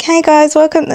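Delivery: high, put-on influencer greeting; sweet TikTok voice. Shown as a demonstration. Creator welcoming TikTok viewers, put-on sweet. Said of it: "I might be like".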